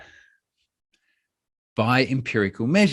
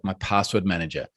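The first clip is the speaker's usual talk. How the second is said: ordinary talk, nothing marked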